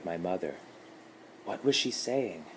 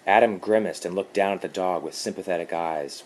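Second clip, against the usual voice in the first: plain reading voice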